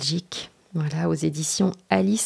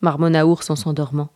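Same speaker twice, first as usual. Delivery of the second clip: animated storytelling, pitched up for the dialogue; children's story reading; 5 semitones higher, 7 dB louder than usual. A narrator's reading. The reader tells of Petit garçon and Ours, animated story narration. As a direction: read a picture book with lively suspense. Reads picture book aloud.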